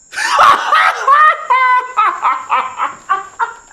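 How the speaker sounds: high, loud whooping laugh; shrieking laugh of joy. Acted. Nephew overjoyed by his uncle's gift, whooping laugh. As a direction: burst out in a shrieking happy laugh.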